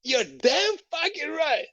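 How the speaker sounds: high, slow, emphatic mock snarl; mock angry lady. The angry lady confirms nobody should knock, slowly, in a high mock snarl. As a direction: drag it out, indignant. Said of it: "She's like".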